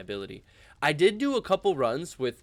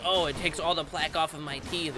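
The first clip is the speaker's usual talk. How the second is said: higher, perky ad voice; mock commercial woman; 6 semitones higher than usual. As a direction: imitate a cheesy toothbrush ad. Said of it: "they're all like".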